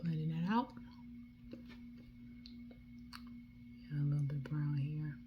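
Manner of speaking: soft whispered commentary. Real, not acted. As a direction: whisper while you work. Makeup tutorial, speaking to camera.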